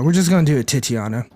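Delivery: ordinary talk, nothing marked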